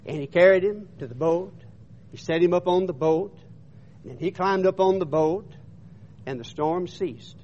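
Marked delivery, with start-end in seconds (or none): none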